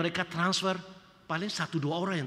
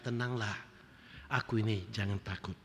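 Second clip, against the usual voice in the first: softer, lower, gentle words of comfort; calm reassurance; 7 semitones lower, 8 dB quieter than usual. Acted. Jesus calming worried believers, softer and lower. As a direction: speak it gently, like a hand on the shoulder.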